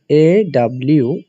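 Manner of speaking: "এ W" spelled slowly, letter by letter; slow spelling out. Shown as a demonstration. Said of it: "তুমি just মনে রাখবা". (ধীরে অক্ষর বলা)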